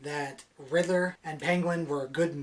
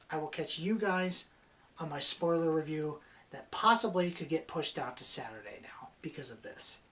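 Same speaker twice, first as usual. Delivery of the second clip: ordinary delivery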